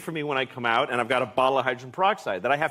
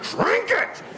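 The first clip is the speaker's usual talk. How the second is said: much higher desperate command; frantic yell. Retold or imitated. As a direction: order the dog to drink, frantic. Yelling at thrashing dog.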